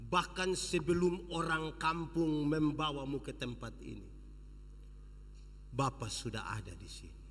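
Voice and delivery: low, soft reassuring father; tender father. Acted. Father reassuring his son, low and tender. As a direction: speak gently to the child. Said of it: "Dia punya bapa bilang".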